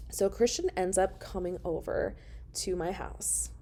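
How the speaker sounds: ordinary talk, nothing marked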